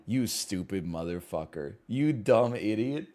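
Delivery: muttered insult. Acted. Mocking streamers who guess wrong.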